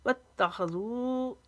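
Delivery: slow recited वत्तखज़ू; tajweed recitation. Shown as a demonstration. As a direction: stretch जू in वत्तखज़ू. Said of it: "जू को लंबा करेंगे".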